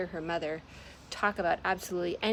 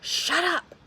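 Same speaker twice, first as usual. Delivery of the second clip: high, louder angry shout; furious shout; 11 semitones higher, 8 dB louder than usual. Acted. Moth yelling at Marzana to stop talking, furious shout. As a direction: yell it to silence her.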